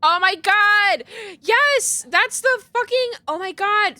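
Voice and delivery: high, loud, slow excited cry. Real, not acted. Gamer recognises the rich guy in the mural, excited. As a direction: burst out with delight.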